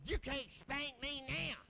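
slow, drawn-out taunt imitating the wife; mock wife voice. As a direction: stretch it out as her playful dare. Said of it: "I'm gonna talk like that".

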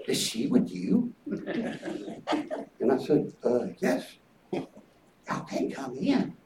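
soft, hushed deacon turning them away; quiet and low, barring the door; a hint of an awkward deacon. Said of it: "The deacons met us".

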